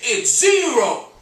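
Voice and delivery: loud, high nightclub emcee; big press-agent announcer shout; screamed stage announcement. Acted. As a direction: bellow the act's introduction to the whole club. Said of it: "he screamed".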